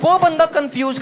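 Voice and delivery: ordinary delivery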